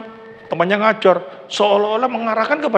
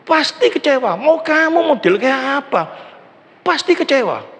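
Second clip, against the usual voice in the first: raised, insistent pasti kecewa; emphatic warning; 7 semitones higher than usual. Acted. Preacher warning the congregation, insistent and raised. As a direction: hammer home that pasti kecewa, that they will surely be disappointed. Preacher drives point home.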